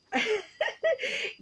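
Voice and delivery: sudden high laugh; giggling at the chat; high burst of laughter. Real, not acted.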